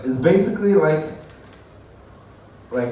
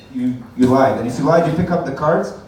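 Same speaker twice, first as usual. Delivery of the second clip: normal talking voice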